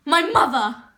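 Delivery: climactic, forceful disclosure; dramatic revelation. Acted. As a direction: hurl out the answer, hard and loud. Climax of revelations about mother.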